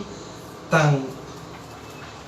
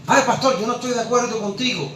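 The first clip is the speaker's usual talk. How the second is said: higher, quicker objecting listener; oyente que discrepa; 5 semitones higher than usual. Acted. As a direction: voice a church member protesting to the pastor, pitched up. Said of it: "Alguien que me está escuchando". (oyente que protesta, voz más aguda y rápida)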